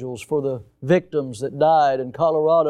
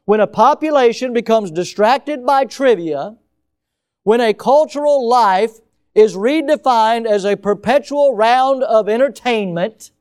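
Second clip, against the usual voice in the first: reading out the quote; 5 semitones higher, 6 dB louder than usual. Shown as a demonstration. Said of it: "what does that sound like?".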